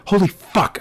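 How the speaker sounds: shocked. A narrator's reading. Learns the caller is a cousin, shocked to self. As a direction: blurt it to yourself, stunned. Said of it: "I was so shocked".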